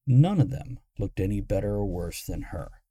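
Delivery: usual speaking voice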